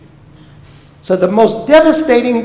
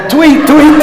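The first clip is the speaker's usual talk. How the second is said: loud, high "ptooey" spitting noises; comic mock spitting, repeated; imitated spitting; 6 semitones higher, 7 dB louder than usual. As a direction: act out a kid trying to spit in his own face. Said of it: "so I would try it".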